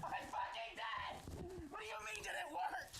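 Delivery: high, strained yelling; exasperated high-pitched shriek; furious outburst. Real, not acted. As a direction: scream at a clueless partner. Bomb exploded, yells at partner.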